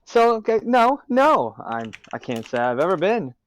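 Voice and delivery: loud, high bursts of showy denial; semi-obnoxious. Acted. Talking over the humans while leering back, semi-obnoxious. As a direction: talk pointedly over the rowdy crowd. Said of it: "semi-obnoxious about this".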